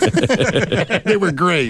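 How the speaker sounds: laughing. Real, not acted. Laughing at T-shirt slogans.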